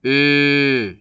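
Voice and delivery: 으 as a long rounded "ö", no glide; steady, sustained front rounded vowel on 으; pure monophthong. Shown as a demonstration. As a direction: hold 으 with fixed rounded lips. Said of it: "이렇게 발음을 해야 이게 정확한 단모음".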